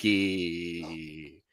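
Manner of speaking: low, soft ghostly drawl; hammed-up spooky whoo. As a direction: draw the word out low and eerie. Spooky theme word in intro.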